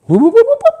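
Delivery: high, loud party whooping; joyful whoop whoop whoop; gleeful whoops. Pretend. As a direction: whoop like you're celebrating. Celebrating racking up debt.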